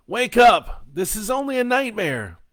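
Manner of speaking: very high-pitched urgent holler. A narrator's reading. Joe tells the boy it's a nightmare, hollering. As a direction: yell to jolt someone from sleep. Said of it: "he hollered".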